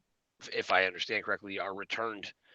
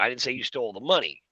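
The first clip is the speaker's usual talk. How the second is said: MONEY lifted, then falling to close; stress on MONEY; 3 semitones higher than usual. Shown as a demonstration. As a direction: land the emphasis on "money". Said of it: "but not the money".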